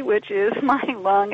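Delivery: normal talking voice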